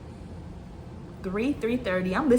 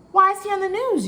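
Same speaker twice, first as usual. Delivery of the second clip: incredulous question; 10 semitones higher, 4 dB louder than usual. Retold or imitated. Voicing the audience's astonished question.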